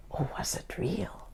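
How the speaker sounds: hushed, wondering; soft mysterious whisper. Acted. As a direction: whisper the question like a secret. Mysterious question.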